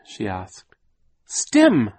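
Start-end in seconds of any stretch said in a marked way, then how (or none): 1.3-1.9 high, loud little shriek. A narrator's reading. Youngest child calling out to her aunt, shrieking. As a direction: shriek the one word out very high. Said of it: "Sonny shrieked".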